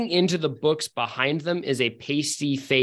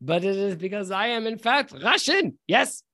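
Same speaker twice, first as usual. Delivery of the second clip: higher-pitched, hammed-up Russian mercenary; thick Russian accent; 5 semitones higher than usual. Mercenary demanding Gareth join his side, thick Russian accent. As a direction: recruit him in a comic Russian accent. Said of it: "with a very thick Russian accent".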